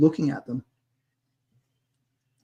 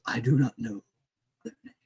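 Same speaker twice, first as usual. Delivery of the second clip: rasping voice; 3 dB quieter than usual. A narrator's reading. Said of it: "it speaks in a rasping voice".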